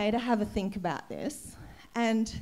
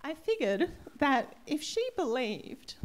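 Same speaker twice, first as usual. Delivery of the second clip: choked up; 4 semitones higher than usual. Real, not acted. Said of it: "I feel really emotional".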